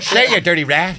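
lightly voiced old gangster-film tough guy; James Cagney. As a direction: do a jokey film-gangster impression. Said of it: "she's like James Cagney".

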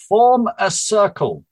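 high, slow, firm command; commanding Charlotte. Acted. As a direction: order the witches into a circle. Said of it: "Charlotte commanded".

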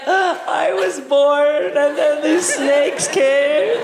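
slow, high, sing-song whining; whiny baby iguana. Retold or imitated. Baby iguana telling its mom, slow sing-song whine. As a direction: wail the sad tale, drawn out. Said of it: "he's like".

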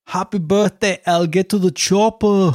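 high-pitched celebrity impression; Werner Herzog. Birthday message for a friend in Werner Herzog voice. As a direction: put on a film director's accented voice, pitched high. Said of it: "Werner Herzog impression".

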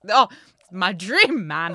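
slow, loud gush of admiration; mock swooning. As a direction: gush it loudly, hammed-up. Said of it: "And I was like".

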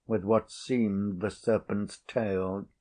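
usual speaking voice

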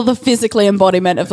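usual speaking voice

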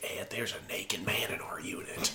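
whispered mock Canadian-ish accent; hushed, breathy imitation of a vague Canadian accent; imitated accent. Woman reporting a naked man in the unit, whispered imitated accent. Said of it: "this, like, accent".

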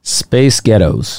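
slow, low American "Space Ghettos"; American accent. Shown as a demonstration. Co-host testing the accent trick, slow American accent. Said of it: "say Space Ghettos in an American accent".

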